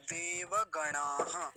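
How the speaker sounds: DEVAGANAAH drawn out slowly, every syllable full; full final va. Shown as a demonstration. Teacher modelling a Sanskrit word for learners, full slow syllables. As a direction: stretch देवगणाः, sounding the va and the closing breath. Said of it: "इसको देव ऐसा बोलेंगे".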